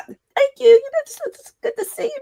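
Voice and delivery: high squeaky strained voice with laryngitis; squeaky lost voice. Retold or imitated. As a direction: squeak the words out, voice nearly gone. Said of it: "my voice is just squeaky".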